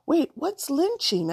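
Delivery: urgent high call after her. Acted. Girl calling after the departing woman, urgent and high. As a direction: call her back before she vanishes.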